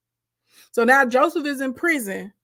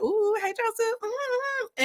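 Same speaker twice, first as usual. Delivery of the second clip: high, breathy-sounding swoon, softer; swooning admirer; 10 semitones higher, 5 dB quieter, slower than usual. Retold or imitated. Women in the house swooning over Joseph. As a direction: coo his name like a smitten fan.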